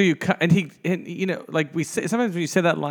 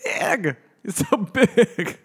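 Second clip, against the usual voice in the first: laughing; 8 semitones higher, much slower than usual. Real, not acted. Laughs through teasing a friend.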